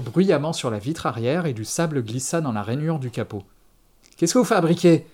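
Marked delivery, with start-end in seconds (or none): 4.2-5.1 louder, higher startled question; sudden alarm at what the other is doing. A narrator's reading. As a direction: exclaim it in alarm. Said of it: "s'exclama-t-il d'un ton alarmé".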